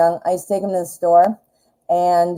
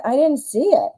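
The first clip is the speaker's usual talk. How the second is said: normal talking voice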